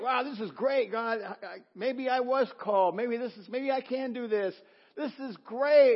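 high, loud, thrilled self-talk; buzzing with excitement at the altar; excited inner thoughts. Retold or imitated. Preacher to church, retelling excitement, high and loud.

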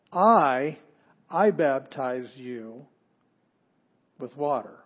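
I punched loud, said slowly; stress on I. Shown as a demonstration. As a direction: lean hard on the first I. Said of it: "it has I in front of it".

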